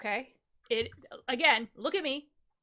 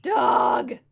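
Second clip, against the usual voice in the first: shouted; 8 dB louder than usual. Pretend. Declares being a dog, shouting.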